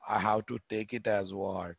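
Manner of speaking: ordinary talk, nothing marked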